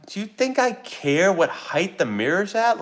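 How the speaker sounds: high, slow incredulous retort; mock exasperation. Husband retorts to wife about mirror height, exasperated. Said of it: "And I'm like".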